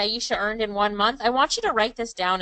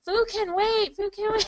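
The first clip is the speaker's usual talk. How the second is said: high-pitched mock protest with a laugh; amused, high imitation of keen pupils; imitating eager students; 9 semitones higher than usual. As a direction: playfully mimic students refusing to leave, pitched well up. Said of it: "They're like".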